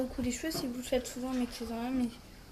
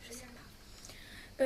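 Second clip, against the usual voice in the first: whispered aside; 6 dB quieter, much slower than usual. Real, not acted. Unsure aside to viewers.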